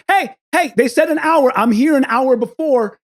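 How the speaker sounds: high-pitched retold protest; urgent calling out. Traveler running past the line to staff, urgent calling out.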